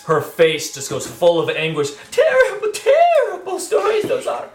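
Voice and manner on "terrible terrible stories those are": high, lamenting townsfolk voice; high NPC voice. Acted. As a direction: wail it like a grieving townsman. NPC laments missing children.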